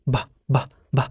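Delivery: "ب" said as a bare b with no "ee" after it; short b sound. Shown as a demonstration. Teacher models the letter B as a bare b. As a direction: tap out "ب" short and light. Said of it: "مش بي".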